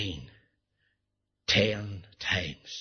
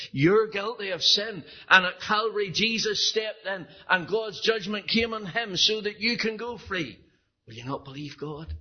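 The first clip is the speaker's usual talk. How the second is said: impassioned preaching; 7 semitones higher than usual. Acted. Preacher urging the congregation to trust Christ. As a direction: press the call to decide tonight. Preaching appeal at full force.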